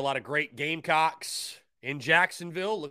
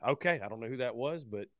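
ordinary delivery

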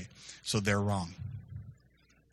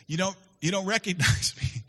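teasing laugh; 7 semitones higher, 4 dB louder than usual. Retold or imitated. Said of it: "He says".

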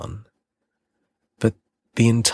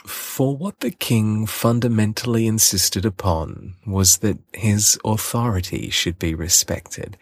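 usual speaking voice

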